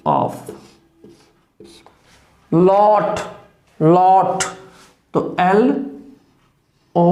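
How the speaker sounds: ordinary talk, nothing marked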